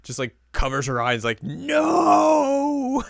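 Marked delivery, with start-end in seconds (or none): 1.4-3.0 high panicked no; panicked shriek. Retold or imitated. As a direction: shriek it to stop them. Riko covering their eyes.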